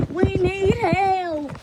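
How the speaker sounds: high, slow whiny plea; hammed-up desperate wail. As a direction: stretch it out like a begging cartoon critter.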